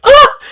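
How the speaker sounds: sudden high loud yelp; dying yelp. Retold or imitated. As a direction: shriek as if struck dead. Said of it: "Merle's like".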